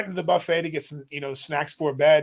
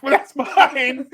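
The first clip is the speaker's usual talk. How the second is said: amused mock complaint; 8 semitones higher, 6 dB louder, much slower than usual. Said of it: "She's like".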